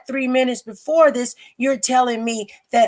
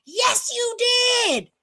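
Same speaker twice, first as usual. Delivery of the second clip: mimicking her scream; 12 semitones higher, 3 dB quieter than usual. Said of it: "listen how she screamed at me".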